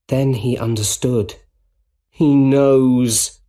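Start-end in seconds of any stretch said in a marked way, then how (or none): 2.1-3.4 louder, emphatic thought; alarmed realisation. A narrator's reading. Peter suddenly understanding the danger, alarmed. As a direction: let the dread land firmly.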